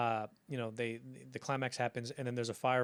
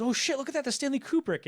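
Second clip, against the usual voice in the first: high, louder burst of amazement; excited sighting; 13 semitones higher, 6 dB louder than usual. Acted. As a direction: blurt it out, thrilled at who you've spotted. Acted excited sighting of Kubrick.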